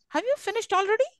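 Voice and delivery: quick, high "Have you finished already", rising; brisk high rise through "already". Shown as a demonstration. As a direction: fire it off high, lifting "already". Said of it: "which is rising up".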